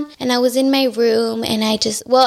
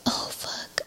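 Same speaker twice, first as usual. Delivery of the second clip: hushed swear under the breath; whispered self-reproach; 14 dB quieter than usual. Real, not acted. Guest realising she mixed up her timeline, whispered self-reproach. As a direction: whisper it to yourself, caught out. Catches own timeline mix-up.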